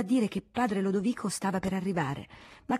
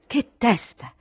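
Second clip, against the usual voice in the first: louder, higher outburst; exasperated exclamation; 4 semitones higher, 5 dB louder, much slower than usual. Acted. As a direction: burst out in annoyance. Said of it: "continuando a parlar solo". (esclamazione stizzita ad alta voce)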